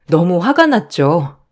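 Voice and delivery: ordinary delivery, nothing marked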